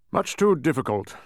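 firm royal reply; replying king. A narrator's reading. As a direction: dismiss it as a king. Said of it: "replied the king".

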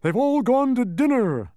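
high, loud strained bug; strained humbug wail; weak announcement. A narrator's reading. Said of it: "announced the humbug weakly".

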